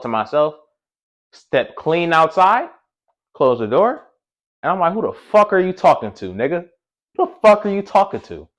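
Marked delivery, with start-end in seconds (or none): 4.9-8.5 raised, higher-pitched challenge; indignant retold comeback; retold angry confrontation. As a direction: fire back at the caller, pitch up. Retells confronting the cursing caller outside.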